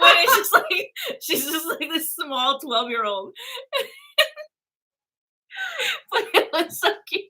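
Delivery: breathless giggly talk; laughing. Real, not acted. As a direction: laugh through it. Giggling over friend's childhood voice.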